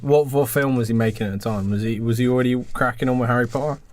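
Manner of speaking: ordinary talk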